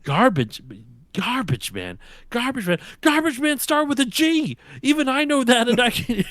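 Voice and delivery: building to a high, loud mock rant; hammed-up mad dad. Dad outraged at son's garbage man answer, mock furious. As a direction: sputter in disbelief, climbing into outrage. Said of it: "he's so mad".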